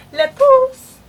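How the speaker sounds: loud high shout; shouting a name. Real, not acted. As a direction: yell the name across the house. Calling out for someone.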